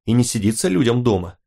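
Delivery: ordinary delivery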